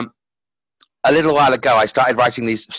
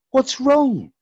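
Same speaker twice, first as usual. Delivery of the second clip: high gentle voice; 12 semitones higher than usual. Acted. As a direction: ask it gently, lifted up high. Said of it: "whispered the light".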